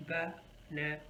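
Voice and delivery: "banana" split as ba-na-na; syllable by syllable. Shown as a demonstration. Teacher asks how many syllables, split apart. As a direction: break "banana" into three separate beats. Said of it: "Of course, three syllables".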